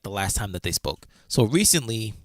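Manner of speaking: usual speaking voice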